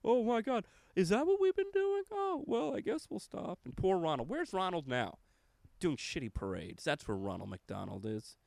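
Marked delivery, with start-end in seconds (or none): 0.0-3.6 high-pitched, put-on shocked realization; mock corporate dismay. Comic voices McDonald's caught doing wrong, high mock dismay. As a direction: act out a company's squeaky, feigned surprise. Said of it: "And McDonald's said".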